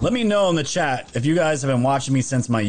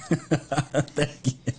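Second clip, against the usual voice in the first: laughing; 4 dB quieter than usual. Real, not acted. Laughs after the chat roast.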